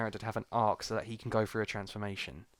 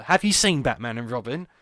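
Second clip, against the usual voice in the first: ordinary talk, nothing marked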